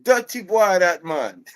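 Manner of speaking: high, loud Jamaican scolding; Jamaican accent. Retold or imitated. Coworker Mark judging the customer, high Jamaican accent. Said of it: "Then Mark is like".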